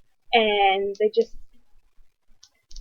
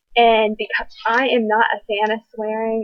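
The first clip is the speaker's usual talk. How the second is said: normal talking voice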